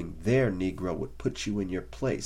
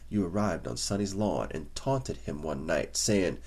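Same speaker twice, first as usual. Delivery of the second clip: ordinary delivery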